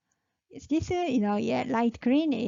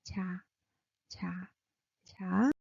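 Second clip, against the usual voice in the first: soft 자; 5 semitones lower, 4 dB quieter, faster than usual. Shown as a demonstration. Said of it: "And then 자".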